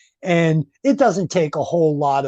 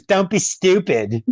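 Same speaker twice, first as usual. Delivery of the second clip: put-on Surge's accent; 4 semitones higher, slower than usual. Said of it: "he says".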